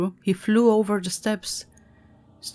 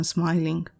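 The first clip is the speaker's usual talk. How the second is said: usual speaking voice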